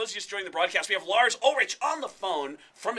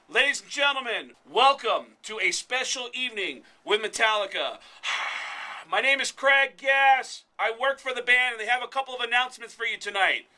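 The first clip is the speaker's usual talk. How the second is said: loud, high, projected stage announcement; arena announcer; 6 semitones higher, 8 dB louder than usual. Retold or imitated. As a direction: boom it out to a concert crowd, stretching key words. Re-enacts announcing to concert crowd.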